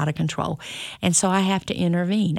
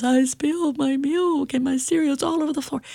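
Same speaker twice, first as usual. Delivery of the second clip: put-on higher little-boy voice, upset about spilled milk; small child's voice; 9 semitones higher than usual. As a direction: play the toddler reporting his mess, pitched up. Son retells spilling cereal.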